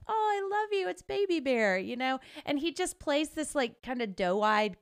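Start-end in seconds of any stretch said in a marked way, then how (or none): 0.1-1.8 very high, cooing fangirl delight; mock swoon, pitched way up; adoring gush. Retold fond gushing at favorite actor.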